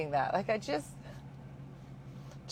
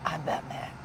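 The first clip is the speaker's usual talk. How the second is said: low gravelly-free Batman growl, lightly done; a hint of Batman; 3 semitones lower, 4 dB quieter than usual. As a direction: quietly imitate Batman's low line. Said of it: "That was pretty good".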